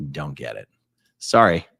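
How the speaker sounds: ordinary delivery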